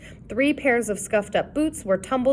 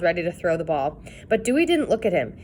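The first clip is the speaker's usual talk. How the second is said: plain reading voice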